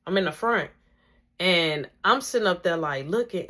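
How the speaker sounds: normal talking voice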